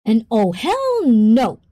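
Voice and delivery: loud, slow outburst of disbelief; a loud, drawn-out oh hell no; shocked hell no. Real, not acted. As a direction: blurt it out, stretched and scandalised. Reacting to a comment from chat.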